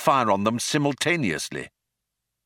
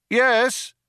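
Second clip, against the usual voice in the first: high, raised answer; the group calling back; 3 dB louder than usual. A narrator's reading. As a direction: call it back. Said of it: "they called back".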